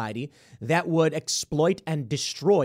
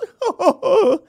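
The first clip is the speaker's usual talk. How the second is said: mocking laugh; 9 dB louder than usual. Real, not acted.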